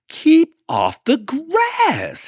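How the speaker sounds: very high, loud and slow; shouted order. Acted. Hank shouting at the passing cowboy. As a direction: bellow the warning. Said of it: "Hank shouted".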